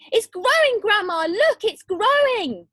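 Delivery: put-on boy shouting to his grandma; excited yell. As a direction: yell it out, thrilled. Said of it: "he yelled".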